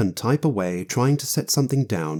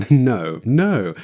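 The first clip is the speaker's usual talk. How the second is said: laughing refusal; 5 dB louder than usual. A narrator's reading. Fate laughing at own idea.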